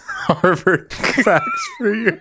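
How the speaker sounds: high, slow, laughing announcer. Pretend. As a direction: sing it out while laughing. Laughing jingle.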